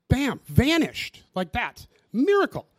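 preacher's rising exclamation; triumphant build. Real, not acted. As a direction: land the word like a revelation. Climax of the miracle story.

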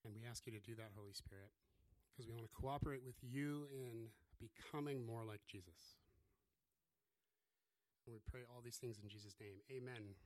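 soft, hushed prayer voice. Acted. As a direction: pray quietly and reverently. Opening prayer.

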